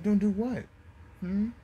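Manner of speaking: high-pitched, challenging question; mock questioning. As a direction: toss it back high, with a questioning hum. Naruto feigns innocence at Gaara's plea.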